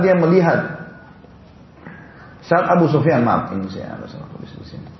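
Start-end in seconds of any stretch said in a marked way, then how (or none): 3.2-4.9 soft whispered apology to himself; whispered aside. Real, not acted. Aside correcting own notes.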